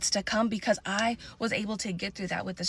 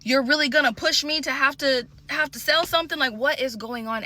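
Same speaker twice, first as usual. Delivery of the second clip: exasperated plea; 7 semitones higher, 4 dB louder than usual. Retold or imitated. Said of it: "I was like".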